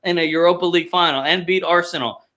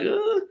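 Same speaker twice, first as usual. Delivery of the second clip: disgusted groan; 6 dB quieter than usual. Retold or imitated. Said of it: "I was just kinda like".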